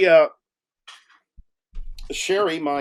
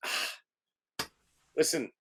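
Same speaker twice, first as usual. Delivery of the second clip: put-on kid's sly plea; 3 semitones lower, 6 dB quieter than usual. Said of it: "He goes".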